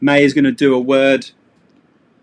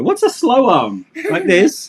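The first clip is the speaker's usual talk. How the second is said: amused disbelief; 12 semitones higher than usual. Real, not acted. Teasing daughter about misreading.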